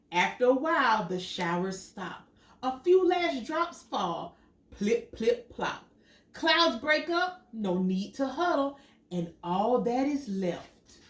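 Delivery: animated picture book reading; reading to children. Acted. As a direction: read it out to little kids with wonder. Reads picture book aloud.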